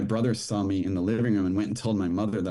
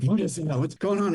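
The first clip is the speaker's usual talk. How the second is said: high-pitched panicked cry of a father; frightened father's shout, pitched high; 9 semitones higher than usual. Retold or imitated. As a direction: voice the drunk father jumping up in alarm. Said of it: "And he freaked out".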